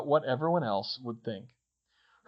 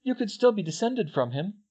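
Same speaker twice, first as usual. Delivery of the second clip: put-on higher-pitched earnest girl's voice; Hermione arguing; 7 semitones higher than usual. Hermione arguing with Harry over his ancestry, earnest. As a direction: reason with a friend in a raised pitch.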